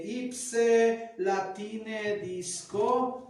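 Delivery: very slow, loud, drawn-out Latin title; Latin recitation. Shown as a demonstration. Teacher recites a Latin title for students, slow and loud. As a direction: intone each Latin word deliberately. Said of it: "o cuando vamos diciendo".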